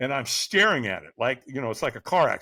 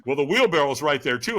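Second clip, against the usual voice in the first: usual speaking voice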